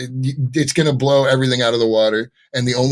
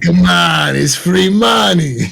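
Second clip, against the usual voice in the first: louder, slow, put-on junk-dealer voice; Watto voice; 6 semitones higher, 5 dB louder, much slower than usual. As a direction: drag out a greedy alien trader's pitch. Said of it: "I turned you into Watto".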